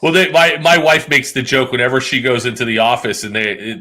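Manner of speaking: ordinary talk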